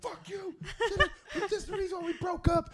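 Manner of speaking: high, mock-angry bickering; high mock row between exes; mock angry fight. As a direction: hurl it like an ex mid-fight. Acted quarrel retold on tape.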